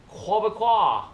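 high "Quebecois", choking on the last syllable; French-Canadian accent, choke out the end of "Quebecois"; mock Québécois accent. Shown as a demonstration. Vlogger practising "Quebecois", choked mock French-Canadian accent. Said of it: "choke on the last claw".

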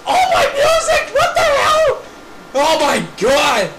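very high, screamed lament; distraught wailing. Pretend. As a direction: wail over your wrecked belongings. Wailing over broken belongings.

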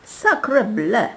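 loud, high French exclamation; exclaiming. A narrator's reading. Court ladies showing off their French, exclaiming. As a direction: call it out in affected admiration. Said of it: "zawołały damy dworu".

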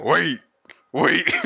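separate, evenly repeated one-word announcements; crosswalk signal voice. Retold or imitated. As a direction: imitate a crosswalk sign's repeated wait. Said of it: "the crosswalk sign".

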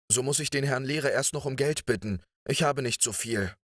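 anxious high-pitched plaint, no real sob; nearly crying. A narrator's reading. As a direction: cry it out as if close to tears. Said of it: "rief er beinahe weinend".

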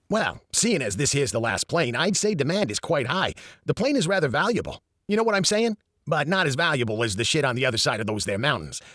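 put-on brash New York accent; a fast-talking New York accent, lightly voiced. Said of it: "Eddie spoke with a strong New York accent".

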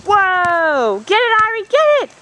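building to a loud high whoop; cheering. Real, not acted. Cheering soccer play.

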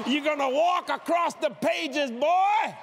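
slow high preaching shout; preacher shouting at the atheist, triumphant. Retold or imitated. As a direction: drive it home like a sermon.

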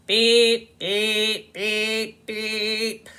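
loud, even beeping; car alarm beeps. Acted. The car alarm adding to the noise, mock beeps.

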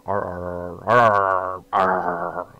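stuck on "are", swelling into a loud sputter; stuttering and sputtering. Real, not acted. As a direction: stumble on "are" until it bursts out loud. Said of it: "stuttering and sputtering and stumbling".

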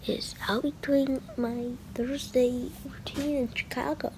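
hushed whisper so nobody wakes up; whispering softly; quiet at night. Real, not acted. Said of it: "Sorry I'm quiet, 'cause it's my other family is not awake".

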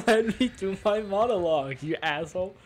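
mock outburst. Real, not acted. Streamer interrupted mid-monologue by friends, loud mock indignation. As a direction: burst out at the hecklers.

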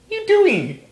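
high startled question to the dog; sudden squeak of surprise; surprised. Real, not acted. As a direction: jump up in pitch, caught off guard.

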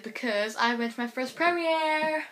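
gushing, pitch climbing to a high woo; thrilled fan sharing big news; real excitement. Real, not acted. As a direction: burst into a high, stretched-out cheer. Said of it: "I'm actually so excited".

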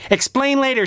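high, urgent call; high and pressing; urgent cry. Acted. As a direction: cry out in a hurry. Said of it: "she cried".

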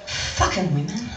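low, soft grumbling, laughing; mock muttering survivor. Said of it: "looking around and going".

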